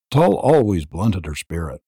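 usual speaking voice

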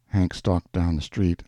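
ordinary delivery, nothing marked

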